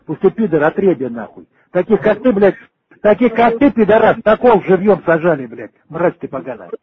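ordinary talk, nothing marked

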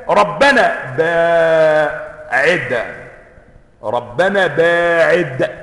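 "ربنا باعد" ممدودة كدعاء طلب; ادعُ بهدوء وبطء شديد; دعاء بطيء. Shown as a demonstration. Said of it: "بيطلبوا من ربنا". (قراءة الطلب "ربنا باعد" ببطء متضرع)

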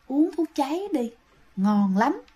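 soft, coaxing ghostly murmur; luring ghost voice. Retold or imitated. Ghost luring villagers to drink poison, soft and coaxing. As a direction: tempt them quietly like a spirit.

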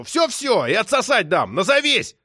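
high, loud, frantic yelling; worked-up high-pitched outburst; furious demand. A narrator's reading. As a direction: shout it down the phone in a rage. Enraged man yelling into the phone.

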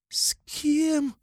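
high hissing snake voice. A narrator's reading. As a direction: hiss it like a serpent. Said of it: "словно змея прошипела Руди".

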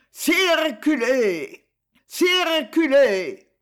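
high, slow, loud police shout; police officer voice. Acted. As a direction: bellow like a traffic cop. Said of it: "il imitait la voix d'un agent de police".

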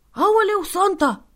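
put-on high, loud child's scolding; bratty little girl. Spoiled girl Antonia scolds Santa for being late, high and loud.

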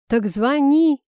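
loud, high urgent exclamation; blurted. A narrator's reading. Rival urges him to call her, blurted loudly. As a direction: blurt it out as an order. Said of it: "выпалил тёзка".

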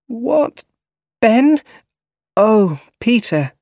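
high-pitched, startled questions, then slowing in recognition; just woken, confused, calling out a name; startled awake. A narrator's reading. Aunt May woken from sleep.

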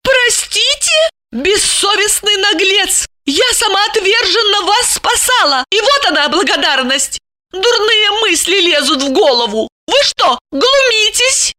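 high, outraged woman's voice; angry indignation. A narrator's reading. Lipochka berates her ungrateful guest, angry and high. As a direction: throw it back high and incredulous. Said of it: "гневный Липочкин взгляд".

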